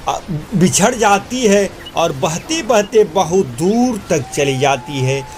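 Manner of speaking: usual speaking voice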